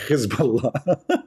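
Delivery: Hezbollah drawn out slowly with a throaty kh, laughing; mock Hebrew accent. Shown as a demonstration. Mocking the Israeli pronunciation, slow and laughing. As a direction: stretch Hezbollah, rasp the h, giggle. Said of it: "it sounds funny, like".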